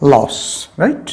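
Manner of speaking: ordinary delivery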